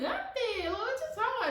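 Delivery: high, laughing denial; nervous fake laugh. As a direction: play innocent with a nervous laugh. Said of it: "a weird laugh".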